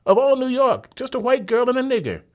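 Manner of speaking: high, loud bystander cry; cried out. Acted. Bystander cries out to the crowd, high loud cry. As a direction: call out in disbelief.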